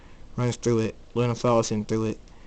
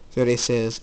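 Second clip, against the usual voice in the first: usual speaking voice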